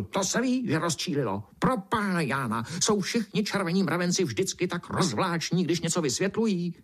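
high, exasperated outburst; squawky, fed-up complaint; sputtering beetle. A narrator's reading. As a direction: burst out crossly in a high voice. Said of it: "černý brouk prskl".

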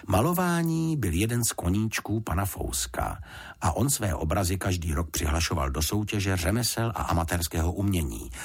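plain reading voice

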